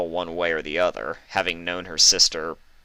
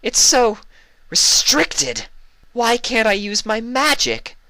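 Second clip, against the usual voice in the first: high, loud, complaining filly voice; whiny Luna; 6 dB louder, slower than usual. Acted. Luna griping to her sister about her lost magic, high and loud. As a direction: protest like a cooped-up young princess.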